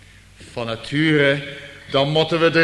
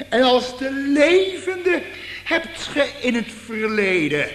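fervent exclamation; 8 semitones higher than usual. Real, not acted. Preacher hailing the risen saviour, high and fervent. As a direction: cry out in praise.

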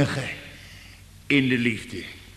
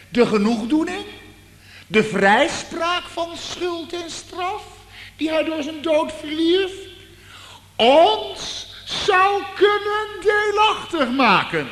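rising to a shout; 13 semitones higher than usual. Real, not acted. Preacher to congregation, building to a shout. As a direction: preach it up to full force.

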